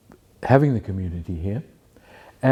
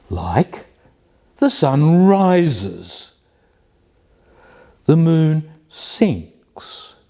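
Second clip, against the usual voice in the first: slow, measured declamation with long pauses; poem recitation; 4 semitones higher, 3 dB louder, slower than usual. Acted. Reading number forty-eight from a poetry book, slow declamatory recitation. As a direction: declaim each phrase, stretching the key words.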